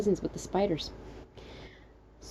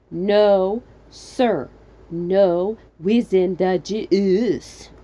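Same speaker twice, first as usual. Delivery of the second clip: loud words with big gaps between; assertive, very slow, word by word; adamant, spaced out; 6 dB louder, much slower than usual. Acted. Narrator calling out to stranger, adamant with spaces. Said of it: "adamantly, assertively, and with spaces".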